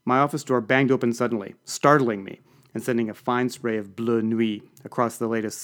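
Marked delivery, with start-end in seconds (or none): none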